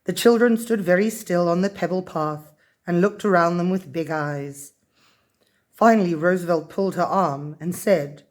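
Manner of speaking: plain reading voice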